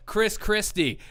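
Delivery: ordinary talk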